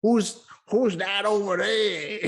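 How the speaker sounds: slow, put-on Appalachian accent, half-crying; slow, high, wailing hillbilly voice; Appalachian hill voice. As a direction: drag it out like a mountain man peering at a stranger. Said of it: "like, Man in the Hills".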